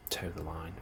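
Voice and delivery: ordinary delivery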